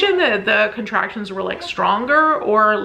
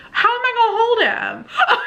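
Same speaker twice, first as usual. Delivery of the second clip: high, half-laughing mock wail; exasperated protest; 13 semitones higher than usual. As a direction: protest the impossible, laughing through it. Exasperated at husband while strapped down.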